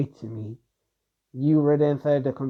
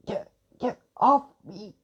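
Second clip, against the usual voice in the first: much higher, strained slow plea while being choked; choking; 8 semitones higher, slower than usual. Acted. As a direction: gasp it out with no air left. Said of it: "losing right there the air she actually has".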